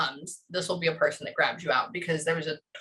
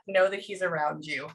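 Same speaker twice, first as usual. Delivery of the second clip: normal talking voice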